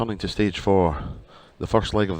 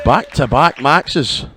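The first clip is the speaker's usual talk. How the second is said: higher, louder and slow; excited; 6 semitones higher, 9 dB louder, much slower than usual. Real, not acted. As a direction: savour the back-to-back maxes. Commentator excited at maximum.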